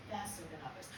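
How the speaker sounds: quiet throwaway repeat; quiet aside. Shown as a demonstration. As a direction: drop it low and soft as an aside. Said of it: "Some kids grew up faster".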